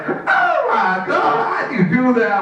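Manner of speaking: very high, slow gasp of awe; hammed-up squealing astonishment; amazed buddy. Buddy watching him get girls, amazed.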